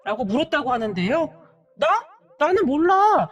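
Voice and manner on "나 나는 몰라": high, indignant talking back; raised-voice, argumentative protest; defiant outburst. A narrator's reading. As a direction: deny it, then turn on her heatedly. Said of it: "바락바락 대들었다고 하네요".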